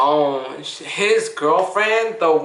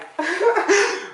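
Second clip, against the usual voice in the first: high burst of giggly laughter; 13 semitones higher than usual. Real, not acted. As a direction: break into a high laugh mid-story. Laughing while retelling the prank call.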